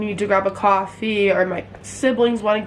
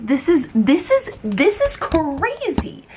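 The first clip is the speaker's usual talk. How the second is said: excited; 6 semitones higher, slower than usual. Real, not acted. Reacting to shocking news.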